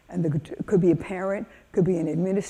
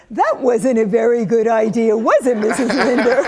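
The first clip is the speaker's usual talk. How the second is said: put-on loud, pitched-up kid telling off the teacher; scolding child; 4 semitones higher, 9 dB louder than usual. One of the kids to Missus Linder, mock scolding. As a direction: imitate a child's loud, knowing reproach.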